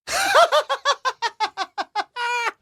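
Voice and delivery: high, manic cackle; madman laugh. Retold or imitated. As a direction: burst into a long crazed laugh. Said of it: "like, a madman".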